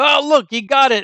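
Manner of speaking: high, bright imitation, pitch jumping up; lightly voiced delighted showman; mock excited fish tank guy. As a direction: play the proud owner crowing at his octopus. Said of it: "and goes".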